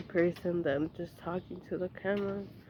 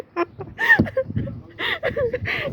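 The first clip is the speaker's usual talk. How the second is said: squealing high laugh; real helpless giggle; high laughing fit; 6 dB louder than usual. Real, not acted. Laughs after failing.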